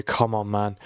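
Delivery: ordinary talk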